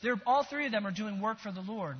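ordinary delivery

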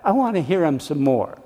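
ordinary talk

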